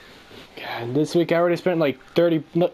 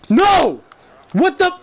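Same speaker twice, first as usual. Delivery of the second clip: much higher and louder, indignant; outraged disbelief; 13 semitones higher, 7 dB louder, much slower than usual. Retold or imitated. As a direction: cry out in disbelief. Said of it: "I'm just like".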